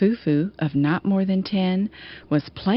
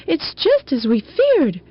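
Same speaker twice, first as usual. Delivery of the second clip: higher, a mild fearful cry; alarmed cry; 9 semitones higher than usual. Acted. Foo Foos warning each other of the cloud, mild alarmed cry. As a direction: sound the alarm, lightly voiced. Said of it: "The Foo Foos cried warning".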